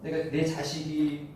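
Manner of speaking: usual speaking voice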